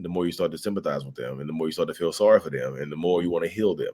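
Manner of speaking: ordinary talk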